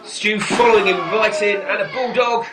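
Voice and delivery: live play-by-play call; wrestling commentator. Acted. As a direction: call the move as it happens.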